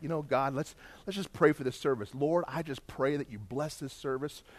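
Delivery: soft, low, offhand prayer; low-key, quiet little prayer; casual prayer. Pretend. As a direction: pray simply, softly and low. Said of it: "we'll just kinda like".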